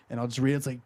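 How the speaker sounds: ordinary delivery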